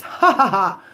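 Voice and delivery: big put-on cackle of triumph; mock laugh. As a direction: laugh it out loud, deliberately. Mimes laughing at enemies' downfall.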